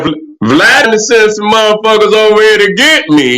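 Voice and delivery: ordinary talk, nothing marked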